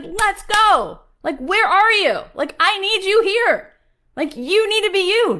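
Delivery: very high, loud urgent call; God summoning the missing ones. Retold or imitated. God voiced calling people.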